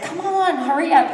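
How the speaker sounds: high, impatient line behind her; impatient crowd. Retold or imitated. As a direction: rush her like annoyed racers.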